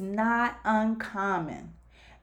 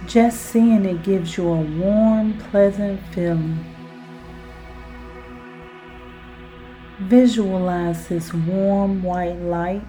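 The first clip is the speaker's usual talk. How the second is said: guided hypnosis; 4 semitones lower, 4 dB louder than usual. Acted. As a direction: lull the listener down into relaxation. Guided self-hypnosis exercise.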